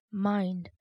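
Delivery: "Mind!" set apart with mild emphasis; emphatic. A narrator's reading. Old man warning the boy to obey, "Mind!" emphasised. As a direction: land on "Mind!" as a parting warning. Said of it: "Placing a strong emphasis on the last word".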